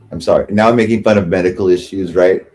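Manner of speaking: ordinary delivery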